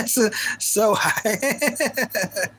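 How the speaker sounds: laughing through the words. Real, not acted. Streamer cracking up on camera, laughing. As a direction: break up laughing as you say it. Cracks up after singing-like line.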